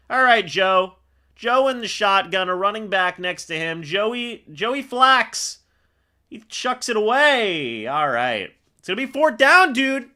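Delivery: live play-by-play. Real, not acted. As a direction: call the snap and throw as it happens.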